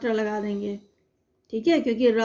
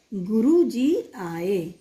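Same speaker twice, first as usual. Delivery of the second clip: slow reading; 3 semitones lower, much slower than usual. Shown as a demonstration. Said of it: "reads sentence slowly to kids".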